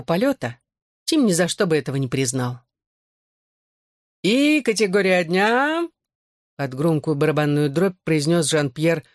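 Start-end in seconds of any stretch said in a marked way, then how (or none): 4.2-5.9 slow dramatic announcement, high; ringmaster. A narrator's reading. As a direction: stretch it out over a drumroll. Host announcing with drumroll.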